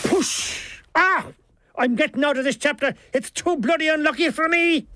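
high, aggrieved Irish character voice; exasperated Milligan. A narrator's reading. Milligan quits the unlucky chapter, exasperated and high. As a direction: cry out, then complain at high pitch.

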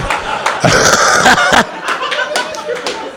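breathy high squealing laugh; high unvoiced wheeze.

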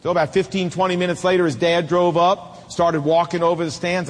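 ordinary talk

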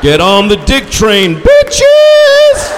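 loud shout soaring into a high scream; hammed-up hollering charge; rowdy war cry. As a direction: bellow it, screaming the last word. Acting out crude male battle cry.

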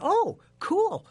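high, bright oh cool; pleased surprise. Retold or imitated. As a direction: squeal out a happy oh. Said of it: "I just went".

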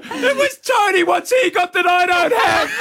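staged scorned-partner shout in a car park; mock jilted yell. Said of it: "I'm going to yell out and say that you cheated on me".

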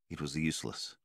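ordinary delivery, nothing marked

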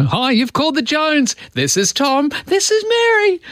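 high, sing-song family voicemail voice; cheery answering machine greeting. Retold or imitated. Host imitating an old family voicemail, cheery and high. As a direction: chirp it like a proud family greeting. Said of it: "leave a message, you know".

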